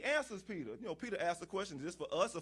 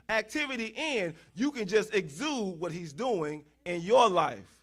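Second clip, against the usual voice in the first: building, forceful preacher's refrain; emphatic preaching; 5 semitones higher, 8 dB louder than usual. Real, not acted. Preacher urging congregation to let the healer work, emphatic preaching. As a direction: drive each repeated plea home to the congregation.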